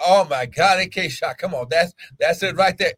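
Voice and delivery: normal talking voice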